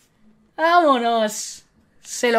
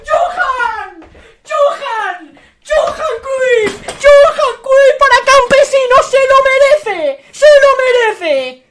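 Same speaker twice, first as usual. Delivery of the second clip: high, drawn-out screams of joy; ecstatic celebration shout; 9 semitones higher, 6 dB louder, slower than usual. Real, not acted. Streamer pulls Johan Cruyff for Campesino, ecstatic shouting. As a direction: shout it like a winning goal, stretching the words.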